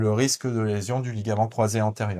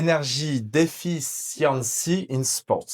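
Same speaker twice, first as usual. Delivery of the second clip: Macron English accent; 5 semitones higher than usual. Shown as a demonstration. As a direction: carefully spell out English, French accent. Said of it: "moi j'ai un accent aussi, un peu à la Macron". (anglais à l'accent français, lent et appliqué)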